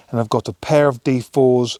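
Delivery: usual speaking voice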